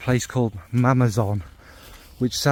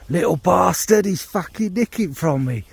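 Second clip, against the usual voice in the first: higher, louder burst of outrage; indignant outrage; 6 semitones higher, 3 dB louder than usual. Acted. Johnny Geezer spotting his pinched bottle, indignant outrage. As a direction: accuse the thief, high and loud.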